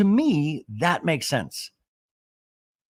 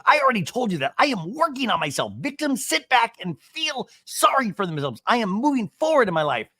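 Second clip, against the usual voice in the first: high-pitched emphatic tirade; hammed-up heated declaration; mock defensive rant; 9 semitones higher, 5 dB louder than usual. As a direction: imitate indignant self-righteous protesting. Acting out Shannon's defensive rant.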